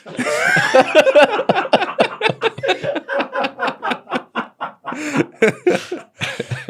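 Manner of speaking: long high-pitched wheezing laugh; long high laughter. Real, not acted. Long laugh at tarantula joke.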